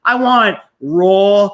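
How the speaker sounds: slow, loud stress on raw; emphatic. Real, not acted. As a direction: lean hard into raw, drawn out. Insisting on raw opinions.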